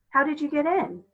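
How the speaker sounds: put-on high, bright child asking; young girl voice. Coraline asking the cat, high girl voice.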